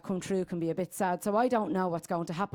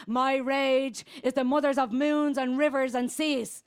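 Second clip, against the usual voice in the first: impassioned litany; 3 semitones higher than usual. Acted. Naming mothers and goddesses of her rage, fierce building invocation. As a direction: chant it out, rising in force with each line.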